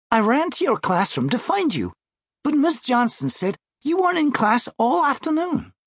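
high-pitched sixth-grade sister; little sister. Acted. Sixth grader confronting her truant brother, high little-sister voice. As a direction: play the kid sister, voice pitched well up.

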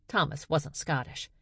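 ordinary delivery

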